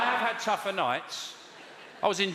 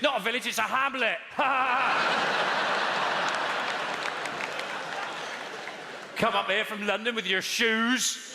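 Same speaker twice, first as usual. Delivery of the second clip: higher, disdainful village local with a sneer; snooty local; 6 semitones higher than usual. Retold or imitated. Comedian voicing a huffy local mocking Londoners. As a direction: look down on the Londoner.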